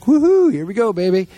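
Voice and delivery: high, loud whoop easing into a cheer; excited cheer. Retold or imitated. Owner celebrating as the pigs get fatter, excited cheer.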